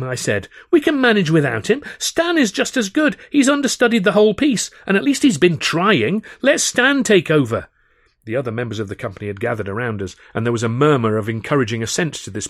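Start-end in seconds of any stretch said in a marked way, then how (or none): 0.7-7.7 1 high urgent argument. A narrator's reading. Arguing with Alf.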